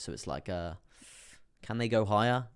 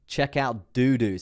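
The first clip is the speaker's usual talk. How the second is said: loud slow read; 4 semitones higher, 9 dB louder, much slower than usual. Retold or imitated. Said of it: "says Leader Crypto".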